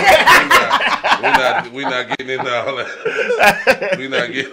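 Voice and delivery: laughing firm refusal; laughing refusal, as for me and my house; laughing through it. Real, not acted. Laughing refusal of multiple husbands.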